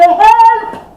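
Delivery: high, loud desperate cry; bawling for help. Retold or imitated. Mother alone in her room, crying out for help loudly. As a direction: scream out for help as if trapped. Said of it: "hold me bawl for help".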